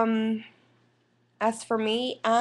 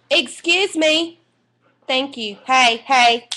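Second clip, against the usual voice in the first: louder, higher real scolding; calling off dogs; 5 semitones higher, 8 dB louder than usual. Real, not acted. Owner to her fighting dogs, loud scolding. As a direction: break in sharply to stop the dogs.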